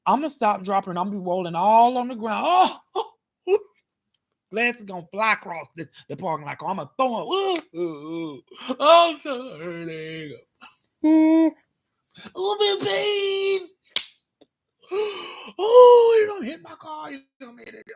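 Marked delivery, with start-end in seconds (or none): 2.5-13.7 hammed-up pained moaning, high and crying; faked injury moans. Pretending he'd fake an injury. 15.6-17.9 mock pained scolding. Pretend injured man scolding.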